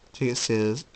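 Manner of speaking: ordinary delivery, nothing marked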